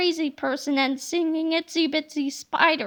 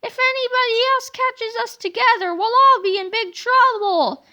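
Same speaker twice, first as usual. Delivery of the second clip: urgent warning; 8 semitones higher, 5 dB louder than usual. Acted. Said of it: "Yesterday George interrupted".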